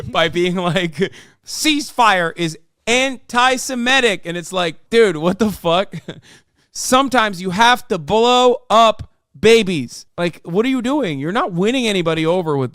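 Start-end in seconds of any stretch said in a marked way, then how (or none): 1.4-4.2 slow, high mock proclamation; mock official account. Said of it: "on Twitter when they're like". 6.7-10.1 slow, loud mock justification; mock official account. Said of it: "when they're like".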